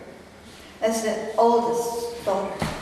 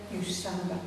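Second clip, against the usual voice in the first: very soft, low mutter, cut off; quiet defiance; 6 semitones lower, 13 dB quieter than usual. Retold or imitated. Dropped sister looking up at her brother, quietly defiant. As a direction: mutter the insult under your breath.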